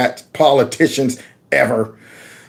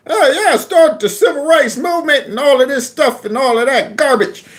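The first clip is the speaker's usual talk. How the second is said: much higher, sing-song mock voice; mocking Creepy; 8 semitones higher than usual. Mocking a politician pandering in a church, high sing-song. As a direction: toss it off in a high teasing imitation. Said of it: "we just saw Creepy the other day".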